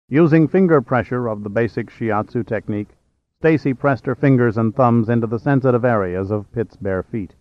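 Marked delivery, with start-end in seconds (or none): none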